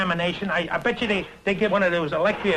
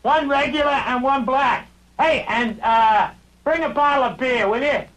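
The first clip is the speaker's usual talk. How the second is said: raised, higher ordering voice; calling out an order; 5 semitones higher, 3 dB louder than usual. Acted. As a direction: call the order across the room, drawn out.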